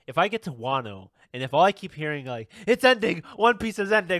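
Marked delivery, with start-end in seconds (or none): 2.6-4.2 high, loud excited chanting; mock hype. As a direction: echo the online hype, overexcited. Imitating hype voices online.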